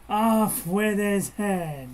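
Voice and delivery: loud, slow, drawn-out royal command; Queen of Hearts. Acted. Speaker mad at somebody turns storybook queen, mock royal outburst. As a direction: play an imperious storybook queen, mock-furious. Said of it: "the Queen of Hearts comes out".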